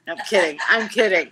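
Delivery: ordinary talk, nothing marked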